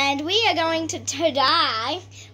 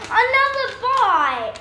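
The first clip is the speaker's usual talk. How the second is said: high, slow whine of dismay; 7 semitones higher, 3 dB louder, slower than usual. Real, not acted. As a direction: wail at the bad luck. Loses the draw again.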